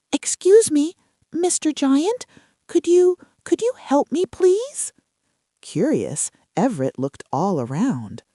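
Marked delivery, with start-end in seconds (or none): 0.1-4.9 high, small sprout voice; tiny pleading voice, much higher. A narrator's reading. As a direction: squeak it out like a tiny seedling. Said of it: "he heard the tiniest little voice".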